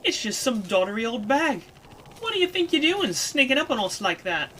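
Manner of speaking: high, scolding character voice; indignant Kid. Acted. Kid to a crone who crept up, indignant. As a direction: tell off the old woman cheekily.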